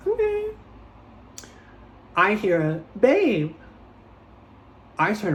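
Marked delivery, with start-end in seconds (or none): 0.1-0.5 squeaky high okay; a shocked little okay; high surprised okay. Retold or imitated. Retold reaction, very high. 3.0-3.5 high, loud call of babe. Retold or imitated. Nicole calling out.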